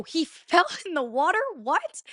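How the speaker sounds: high-pitched disbelieving question with a laugh; incredulous echo. Retold or imitated. Girlfriend hears her boyfriend fell in, incredulous. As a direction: re-enact stunned disbelief, pitch jumping up. She echoes the news in disbelief.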